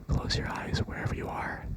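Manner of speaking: ordinary talk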